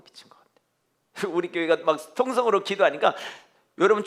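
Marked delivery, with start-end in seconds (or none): 0.0-1.3 whispered. Retold or imitated. Voicing onlookers' remark.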